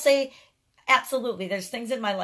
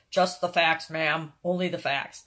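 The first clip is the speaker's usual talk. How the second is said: detective impression; 3 semitones lower, slower than usual. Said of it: "Joe something would always say".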